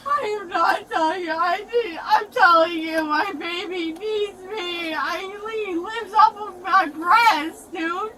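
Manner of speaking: weepy, slowed insistence; tearful pleading. Real, not acted. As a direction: plead through real sobs. Tearfully insisting baby needs her.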